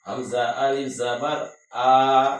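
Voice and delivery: final آ held out long, a full alif length; stretched long vowel. Shown as a demonstration. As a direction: drag the آ out for one alif. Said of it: "اتنا کھینچیں گے".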